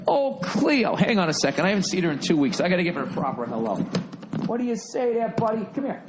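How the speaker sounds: doting voice for the dog; talking to the dog. Real, not acted. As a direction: coo hello at the dog. Greeting his dog Cleo.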